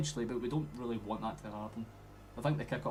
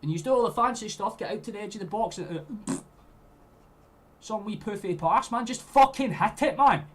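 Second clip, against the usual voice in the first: much higher and louder, easing down; angry rant; 9 semitones higher, 10 dB louder than usual. Real, not acted. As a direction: berate the players through the screen. Ranting again about fancy passing.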